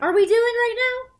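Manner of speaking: high, loud disbelief; Qu'est-ce qu'on fait là ? incrédule, plus fort; incredulous outburst. Real, not acted. Exasperated outburst.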